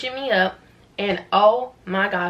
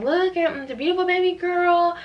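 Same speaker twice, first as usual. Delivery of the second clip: delighted announcement; 10 semitones higher than usual. Retold or imitated. Staff show mother her newborn, high imitated delight. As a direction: present the newborn in a high, admiring voice.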